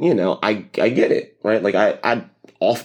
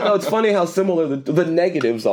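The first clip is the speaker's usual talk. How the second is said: laughing; 11 semitones higher than usual. Real, not acted. Amused by the comparison.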